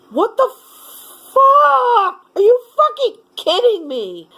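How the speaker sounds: high, loud, incredulous shouting curse; furious outburst. Real, not acted. Caller exploding at the phone agent, real furious shout. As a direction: blow up in real disbelief, shouting.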